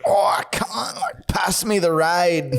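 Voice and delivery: Aussie mate cracking up mid-sentence; Australian accent. Retold or imitated. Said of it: "Nathan's in the front seat and he's like".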